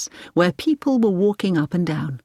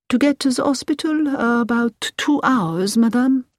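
put-on higher-pitched French-accented driver; 7 semitones higher than usual. A narrator's reading. Driver tells Hilary the journey time, French driver voice. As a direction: voice a local driver, lifted in pitch.

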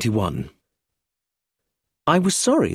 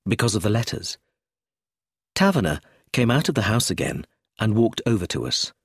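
ordinary delivery

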